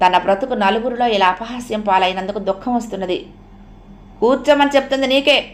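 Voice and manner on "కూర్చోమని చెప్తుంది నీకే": raised, sharp command to sit down; firm loud order. Acted. Husband orders his new bride to sit, loudly. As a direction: bark the order at her. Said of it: "గట్టిగా అన్నాడు".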